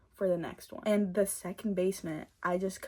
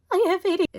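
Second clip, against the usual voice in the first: mock announcement; 12 semitones higher, 11 dB louder, much faster than usual. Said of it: "I said, "Mom".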